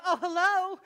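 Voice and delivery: mock sugary phone voice, a sudden swing from the argument; sweet phone voice. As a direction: switch into the polite voice you use when the phone rings. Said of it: "It's like a completely different voice".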